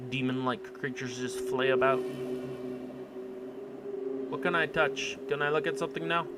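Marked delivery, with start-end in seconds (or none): none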